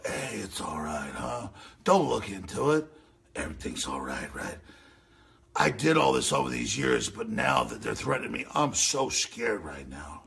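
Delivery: creepy insider voice. Retold or imitated. Host voicing the pizza place crowd, low, soft and sinister. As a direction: purr it like a guilty man soothing. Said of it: "at the pizza place going".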